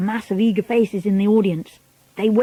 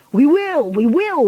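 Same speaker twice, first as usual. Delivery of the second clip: high, raised crowd reply; eager audience; 6 semitones higher, 4 dB louder than usual. A narrator's reading. Witches answering their leader, eager cry. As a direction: answer keenly in two bursts. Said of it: "they answered".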